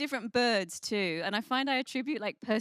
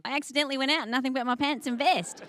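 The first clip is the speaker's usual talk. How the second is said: brisk laughing mock Australian, a bit higher; Australian accent; 4 semitones higher, 4 dB louder, faster than usual. Comic imitating an Australian saying the same line, Australian accent. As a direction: tell it like a cheerful Aussie. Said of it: "an Australian person saying".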